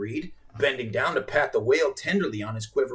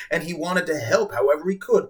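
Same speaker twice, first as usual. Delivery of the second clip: ordinary delivery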